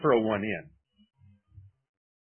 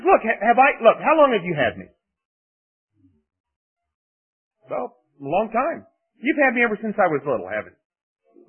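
high, loud pleading donkey voice; 10 semitones higher, 8 dB louder than usual. Retold or imitated. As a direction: plead as the wronged donkey, pitched up. Preacher voicing the talking donkey.

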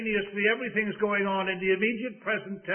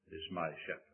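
hushed; 11 semitones lower, 14 dB quieter, much faster than usual. Acted. Repeated quote, hushed.

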